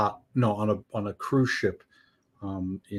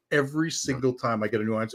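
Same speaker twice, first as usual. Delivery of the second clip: normal talking voice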